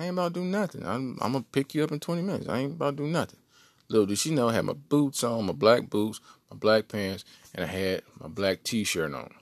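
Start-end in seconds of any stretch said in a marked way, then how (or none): 0.0-3.4 innocent act. Retold or imitated. Reassuring girlfriend, lying.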